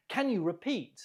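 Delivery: usual speaking voice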